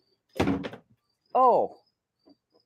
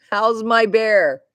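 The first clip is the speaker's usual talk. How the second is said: slow, sweet, slightly higher and louder, mimicking him; doting husband voice; 5 semitones higher, 7 dB louder, much slower than usual. Husband asking about the dog, a slow doting mock voice. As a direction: draw it out lovingly like her husband. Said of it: "he goes".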